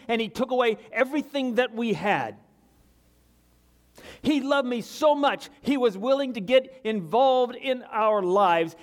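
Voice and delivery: high and loud, pressing each word home; impassioned preaching. Real, not acted. Preacher testifying to congregation, impassioned preaching. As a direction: preach it with fire.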